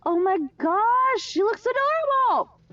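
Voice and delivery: high, slow squealing delight. Real, not acted. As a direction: squeal over something cute. Squealing over cute drawing.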